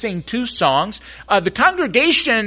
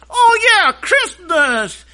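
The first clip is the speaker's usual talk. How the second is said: high, loud, stretched out; light up at the answer; puppet delight; 11 semitones higher, 5 dB louder, much slower than usual. Acted. Puppet realizing the holiday, high and drawn out.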